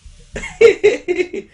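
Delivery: high, loud rapid giggle; short burst of high laughter; gleeful giggle. Acted. Gleeful at the newcomer.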